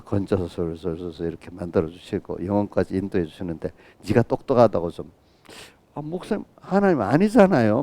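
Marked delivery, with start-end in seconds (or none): 5.9-7.8 high-pitched mock complaint, imitating a know-it-all; mock noisy protest. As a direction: play the smart aleck pushing back at the pastor, higher. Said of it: "막 시끄럽게 막 그래".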